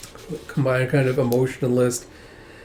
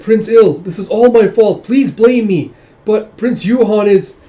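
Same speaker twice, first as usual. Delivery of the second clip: high, loud acted plea; desperate pleading; 9 semitones higher, 10 dB louder than usual. Woman begs Prince Il to blame her, desperate. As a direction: implore him, pushing the voice up.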